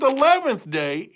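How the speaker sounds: plain reading voice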